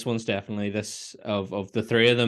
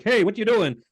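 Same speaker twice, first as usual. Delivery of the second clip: high, alarmed call; quoting Homer catching Lisa; mock Homer alarm; 9 semitones higher, 3 dB louder than usual. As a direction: call out like a startled dad. Quoting Homer grabbing Maggie.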